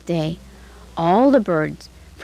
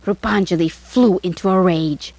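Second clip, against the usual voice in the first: ordinary delivery, nothing marked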